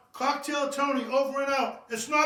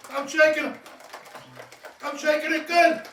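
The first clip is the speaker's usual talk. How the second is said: louder, hyped shaking call; pumped-up; 4 semitones higher, 5 dB louder than usual. Shown as a demonstration. Bartender shaking the cocktail, pumped-up call. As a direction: sell the shake with energy.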